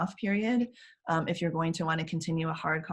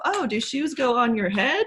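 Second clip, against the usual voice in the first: mock clueless; 6 semitones higher, 6 dB louder than usual. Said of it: "pretend to not know something".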